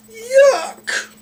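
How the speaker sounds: very high, loud disgusted shriek; girl stepping in something smelly, shrieking. A narrator's reading. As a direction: squeal in disgust at the mess. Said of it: "shrieked Dee".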